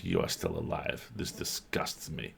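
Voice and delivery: very low, fading to a whisper; haughty cat voice. Acted. GM voicing the cat Frou Frou with a low, fading sneer. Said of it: "Frou Frou's like".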